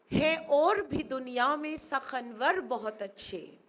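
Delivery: ordinary delivery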